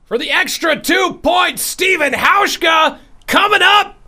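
high, loud excited play-by-play; sports announcer. Pretend. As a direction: call it like a stadium announcer. Mock sports play-by-play call.

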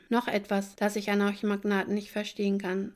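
ordinary delivery

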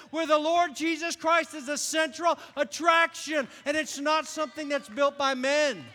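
loud, high sermon shout; fired-up preacher building to a shout; preaching at full force. Real, not acted. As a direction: drive it home to the congregation. Preaching climax.

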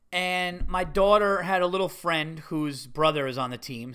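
ordinary talk

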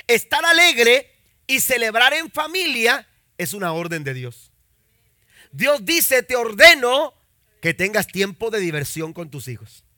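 loud, high, fervent sermon delivery; preaching at full force. Real, not acted. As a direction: preach it to the congregation. (predicación fervorosa, fuerte y aguda)

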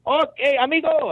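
high-pitched mocking imitation; mock chummy greeter. Mimics men using Spanish on him.

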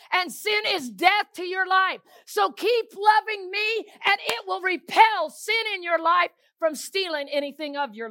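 fiery preaching, loud and high. Acted. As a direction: preach it out to the congregation. Preaching at full force.

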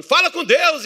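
loud, high exhortation; pastor convocando a orar. Retold or imitated. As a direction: cry out to stir the congregation. Pastor's call to pray, imitated. (brado agudo de pastor exortando)

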